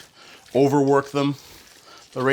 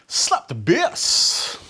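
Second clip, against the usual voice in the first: very slow, higher mock-Jamaican accent; Paul Rudd; 4 semitones higher, much slower than usual. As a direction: stretch it out in a silly fake Jamaican accent. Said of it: "he always sounds like he's Jamaican".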